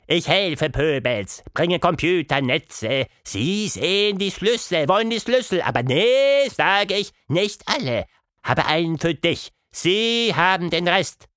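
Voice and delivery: a broken-speaking little mouse. A narrator's reading. Mouse explains in broken speech.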